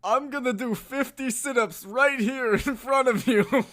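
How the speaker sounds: proud aging boxer, mumbling; Stallone impression. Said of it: "who has the better Stallone impression".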